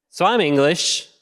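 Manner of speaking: ordinary talk, nothing marked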